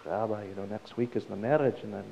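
low, quiet, hesitant question; acted nervous mumble; nervous boy. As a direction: ask the rabbi timidly, with small halts. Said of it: "very nervous".

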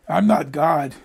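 usual speaking voice

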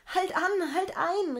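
high, loud, desperate cry; terrified girl shouting in the storm ride; frightened shout. A narrator's reading. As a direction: scream at the beetle to stop. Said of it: "rief das Mädchen".

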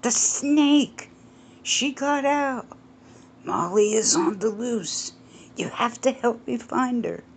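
high, frantic plea for help; pleading. Acted. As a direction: beg him to help, panicked. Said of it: "Justin pleaded".

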